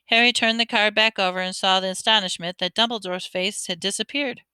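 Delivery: ordinary delivery, nothing marked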